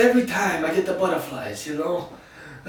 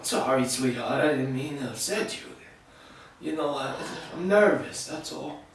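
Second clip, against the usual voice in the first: low, soft apology; husband softly apologizing to his wife; tender apology; 5 semitones lower, 8 dB quieter than usual. Acted. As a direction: soothe her gently.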